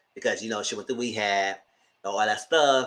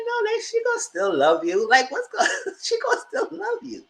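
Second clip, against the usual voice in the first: high, laughing "she gonna still love you"; laughing reassurance. Retold or imitated. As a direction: reassure her while laughing. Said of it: "She said".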